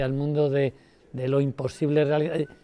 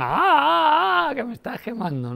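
much higher, louder mock cry of being burned; burned by passion; 13 semitones higher, 5 dB louder than usual. Audience member imagined crying out at the fire, high mock yelp. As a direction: yelp high as if scorched, then drop into the words. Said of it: "y que digan".

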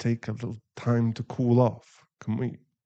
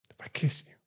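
shy whisper; 5 dB quieter, much faster than usual. Acted.

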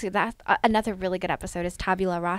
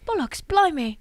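hammed-up Cockney "Bollocks, blimey"; a clumsy British impression; bad British accent; 9 semitones higher, 3 dB louder than usual. Imitating Spike, a hammed-up bad British accent. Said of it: "that was really bad".

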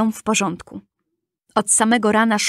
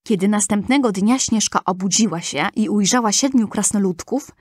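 ordinary delivery, nothing marked

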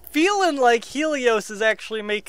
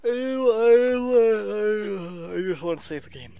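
long wailed start sinking to a mumble; whiny pleading; 2 semitones higher than usual. Real, not acted. Player still waiting to save, whiny plea fading away. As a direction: whine it out, then deflate. Drawn-out whine, trying to save.

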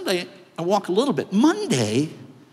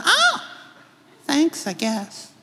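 puzzled intern; 9 semitones higher, much slower than usual. Retold or imitated.

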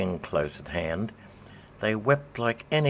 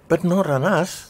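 higher, louder alarmed cry; frightened oysters yelping a protest; 9 semitones higher, 8 dB louder than usual. A narrator's reading. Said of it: "the oysters cried".

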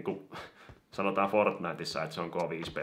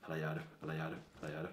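soft plea; 2 semitones lower, 11 dB quieter, much faster than usual. Real, not acted. Pleading with lagging phone.

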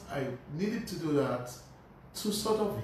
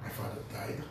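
stunned whisper; 6 semitones lower, 8 dB quieter than usual. Retold or imitated. Hearing of father's death.

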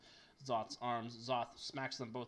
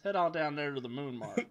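put-on Space Alabama accent; 4 semitones higher, 14 dB louder than usual. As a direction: play a space hillbilly from Alabama, loud. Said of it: "Space Alabama".

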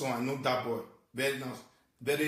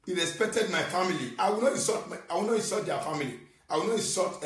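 agitated rant; 5 semitones higher than usual. Real, not acted. Upset rant about an insulted wife.

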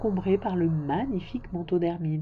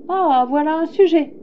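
high, loud royal exclamation; loud, delighted monarch's cry; king exclaiming; 8 semitones higher, 8 dB louder than usual. A narrator's reading. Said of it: "s'écria le roi".

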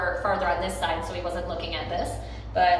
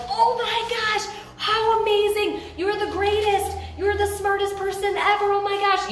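very high, gushing hype; shower someone with breathless praise; 12 semitones higher, 3 dB louder than usual. Pretend. Imagined friend hyping you up, high and gushing. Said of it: "they were like".

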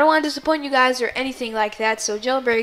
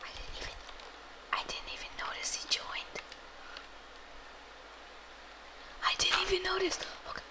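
whisper; 8 semitones higher, 16 dB quieter, faster than usual. Real, not acted. Whispering while recording.

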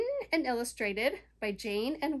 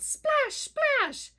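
two high, bouncy splashes; splashing sound words; 12 semitones higher, 5 dB louder than usual. Acted. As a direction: pop each splash up high. Imitating paddling splashes.

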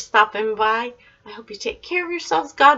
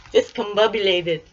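ordinary delivery

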